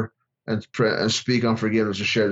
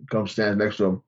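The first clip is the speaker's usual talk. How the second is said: usual speaking voice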